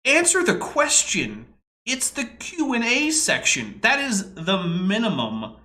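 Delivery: high, slow, emphatic demand; real exasperation. Real, not acted. Viewer scolding a speaker in a Q and A session, exasperated. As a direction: spell it out slowly, fed up. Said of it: "this is annoying".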